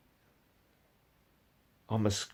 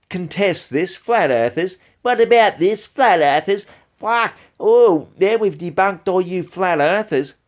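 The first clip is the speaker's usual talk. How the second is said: higher, louder sneering parody of a smug debunker; mocking imitation; 8 semitones higher, 7 dB louder than usual. Mocking the YouTuber who keeps taunting flat earthers, in a loud high sneer. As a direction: ham up the rival's gloating. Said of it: "every single time you have to keep saying".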